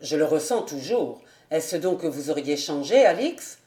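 low, earnest officer questioning; young captain. A narrator's reading. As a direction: ask her in the captain's low voice. Captain questions Alix in dialogue.

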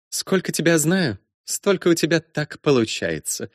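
high, brisk, bossy woman; brash princess voice. Acted. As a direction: play her as a bold, teasing princess. Matilda voiced by narrator.

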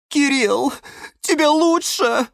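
very high, tearful plea; Галатея sobbing. A narrator's reading. Orchid asking the fainted cactus, sobbing. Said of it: "рыдала орхидея Галатея".

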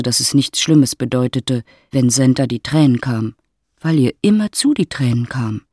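ordinary talk

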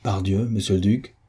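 ordinary delivery, nothing marked

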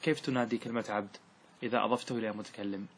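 usual speaking voice

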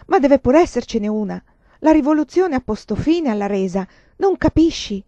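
pleading. Acted. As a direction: beg her to see another way.

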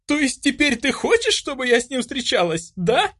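very high, incensed challenge; furious high-pitched disbelief; sudden choking anger. A narrator's reading. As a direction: fire it back outraged. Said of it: "Чуть не задохнулась я от внезапно накатившей злобы".

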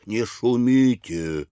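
slow stern warning; строго. A narrator's reading. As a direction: order it firmly, drawn out. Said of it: "строго заметил Кит". (строгое медленное предупреждение)

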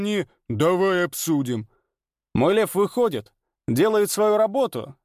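high, bragging arena star; swaggering showman. Acted. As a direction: boast grandly to the crowd. Ranner voiced throughout scene.

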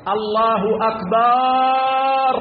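أكبر with the alif before the ra stretched long and slow, chanted; slow drawn-out akbar of elderly callers; elongated akbar. Shown as a demonstration. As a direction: drag out أكبار like an old muezzin. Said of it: "فيمد الألف قبل الراء".

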